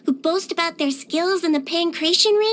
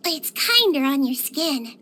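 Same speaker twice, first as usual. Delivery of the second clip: normal talking voice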